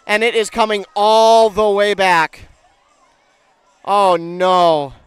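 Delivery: loud dismay, fading down; disappointed call. Real, not acted. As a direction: groan as the score comes back. Touchdown called back by flag.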